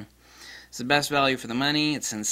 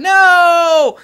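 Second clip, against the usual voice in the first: high, loud mock cry; mock horrified cry; 17 dB louder than usual. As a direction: yell like a horrified viewer. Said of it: "people out there going".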